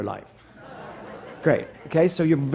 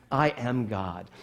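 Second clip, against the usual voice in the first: quiet declaration; 7 semitones lower, 8 dB quieter, much slower than usual. Real, not acted.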